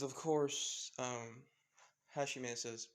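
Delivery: ordinary delivery, nothing marked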